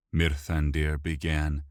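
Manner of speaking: ordinary delivery, nothing marked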